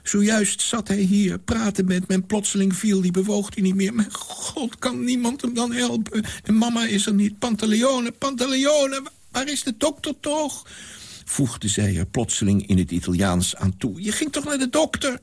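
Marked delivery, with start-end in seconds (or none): 0.0-10.7 put-on high, frantic young woman's voice; panicked girl. A narrator's reading. Girl's panicked speech. 14.0-15.2 put-on high, frantic young woman's voice; panicked girl. A narrator's reading. Girl asks old man.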